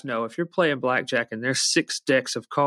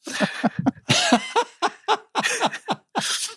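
high laugh. Real, not acted. Joke about normies killing them.

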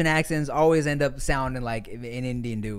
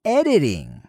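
higher, upbeat podcast sponsor read; 4 semitones higher than usual. Real, not acted. Host pitching a writing assistant to students, lively ad read. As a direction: sell it to the listeners.

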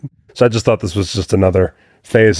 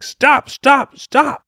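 high-pitched, panicked-sounding mock scream; retold scream, high and urgent; much slower than usual. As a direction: imitate a screamed stop, pitched way up without much volume. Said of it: "the bartender just starts screaming".

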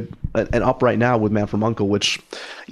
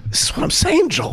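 much higher, exasperated cry to the cohost; stressed exclamation. Real, not acted. Host to cohost, fretting over Twitter handles, high exasperated cry. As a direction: throw up your hands in playful panic.

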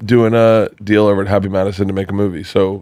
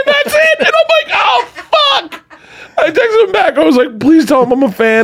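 far higher, laughing shout; panicked shout. Retold or imitated. Comedian reliving the voicemail, far higher, half laughing. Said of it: "panicked retelling".

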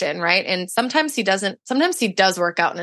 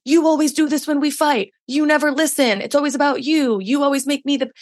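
mock fight yell; 6 semitones higher than usual. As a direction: replay the old heated accusations, pitched up. Retold couple's fight.

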